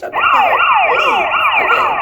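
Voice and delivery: high, loud, squeaky mockery of a cheater. Vlogger mocking a test-taker's excuses in a squeaky voice. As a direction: shriek it up high like a whiny kid.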